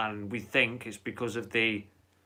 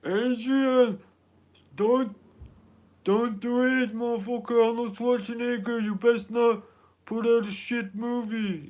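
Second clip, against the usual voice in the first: loud, very high-pitched hollering boxer voice; hammed-up, strained high yell; Sylvester Stallone; 13 semitones higher, 6 dB louder than usual. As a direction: holler it high like a bad movie-boxer impression. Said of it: "trying to do, um, Sylvester Stallone".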